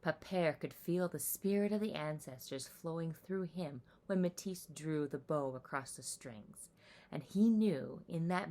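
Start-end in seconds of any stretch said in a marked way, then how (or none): none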